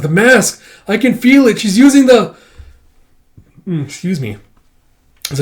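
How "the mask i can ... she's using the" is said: high and louder, rising alarm; a child sensing the mask, building panic; excited Baby Micah. Acted. Baby Micah feeling the mask being used, high and alarmed. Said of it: "Baby Micah's like".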